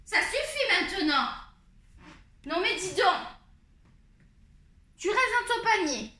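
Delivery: high, firm telling-off; scolding the cat. Real, not acted. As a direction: send the cat back to its basket, voice up high. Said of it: "là, je suis en colère". (ordonne au chat d'aller au panier, voix haute)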